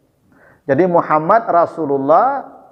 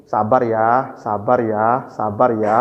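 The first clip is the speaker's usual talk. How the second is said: soothing; 7 semitones lower, slower than usual. Retold or imitated. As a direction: hush the weeping man gently. Umar pretending to comfort Abu Jandal.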